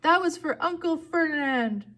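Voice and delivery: high, loud shout back; high-pitched avenging shout; defiant yell. A narrator's reading. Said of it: "Grover yelled back".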